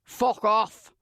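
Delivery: raspy Scottish brush-off; gruff Scottish accent. A narrator's reading. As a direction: spit it out in rough Scottish. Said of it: "comes the reply".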